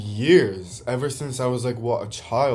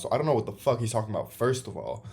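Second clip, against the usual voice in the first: usual speaking voice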